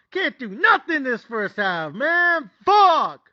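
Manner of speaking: high, exasperated fan yelling; getting mad. Retold or imitated. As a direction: plead and curse at your losing team. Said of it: "I'm getting mad".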